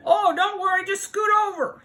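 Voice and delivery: high, breezy animal voice; insistent animal. Acted. Newcomer urging the animals over, high and insistent. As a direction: brush off the worry, pitched high. Animal urges others to make room.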